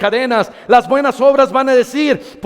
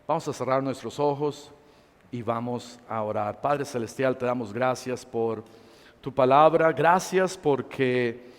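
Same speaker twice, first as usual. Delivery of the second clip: soft altar-call preaching; 10 semitones lower, 12 dB quieter than usual. Acted. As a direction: lower the voice and draw them into prayer. Soft intimate preaching into prayer.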